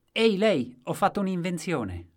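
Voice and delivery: high, loud hail to a stranger; eager calling out. A narrator's reading. Accosting a stranger in a story.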